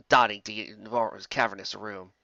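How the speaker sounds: ordinary delivery, nothing marked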